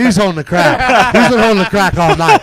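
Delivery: loud, high, worked-up rant about the crack. Retold or imitated. Storyteller ranting loudly about his friend holding the crack.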